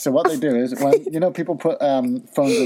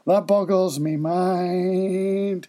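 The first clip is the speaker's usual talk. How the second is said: slow, drawn-out mock amazement; hammed-up catchphrase; 5 semitones higher, much slower than usual. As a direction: intone it slowly, higher than usual. Performing the segment catchphrase.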